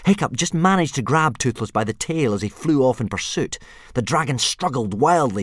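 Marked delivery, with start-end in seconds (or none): none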